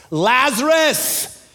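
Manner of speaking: drawn-out high call of the name; loud call. A narrator's reading. Jesus calling into the tomb, a loud drawn-out cry. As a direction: call the dead man's name out, slow and strong. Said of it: "Jesus called in a loud voice".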